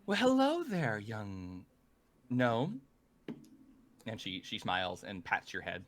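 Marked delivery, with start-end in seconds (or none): 0.1-2.8 sweet greeting. Acted. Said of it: "Amantha looks up at you and says".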